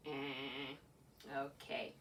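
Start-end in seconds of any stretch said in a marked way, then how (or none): 0.1-0.8 low quiet buzzer "eh". Shown as a demonstration. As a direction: grunt a low wrong-answer buzz. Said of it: "eh if it doesn't".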